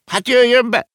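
very high, loud command; uncle's emphatic shouted order; giving an order. A narrator's reading. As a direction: call the visitor in loudly. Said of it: "adta ki a parancsot Ringelhut bácsi".

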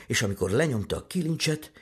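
usual speaking voice

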